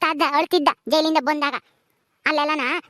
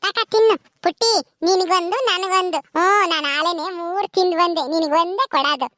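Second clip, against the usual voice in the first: slow, drawn-out put-on voice; childlike young aunt; slower than usual. Young aunt offers the baby a laddu, slow childlike voice. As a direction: draw the words out coaxingly for a baby. Plays Paddu offering a sweet.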